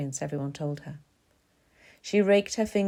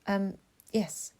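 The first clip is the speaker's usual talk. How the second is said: reluctantly polite, hesitant little answer; polite but discouraging; 3 semitones higher than usual. A narrator's reading. She answers a chatty stranger in the queue, guardedly polite. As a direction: answer courteously, inviting no more talk. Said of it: "as politely as possible, but without wishing to encourage".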